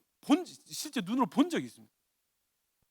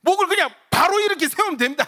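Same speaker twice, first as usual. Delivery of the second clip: firm command; 8 semitones higher, 8 dB louder, faster than usual. Retold or imitated. Pastor instructs the stiff sister.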